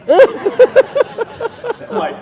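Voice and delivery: big burst of laughter; laughing. Real, not acted. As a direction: laugh out loud, high and loud. Laughing at the Pooh Vader bit.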